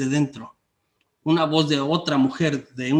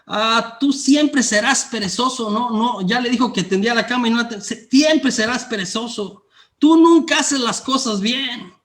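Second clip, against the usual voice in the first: scolding parent; 6 semitones higher than usual. Retold or imitated. Preacher voicing a parent telling a child he'll always be lazy, mock scolding. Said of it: "decimos cosas como".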